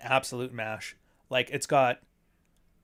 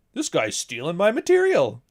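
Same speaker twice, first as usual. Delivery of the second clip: mock indignant; 5 semitones higher, 6 dB louder than usual. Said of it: "quote tweeted it going".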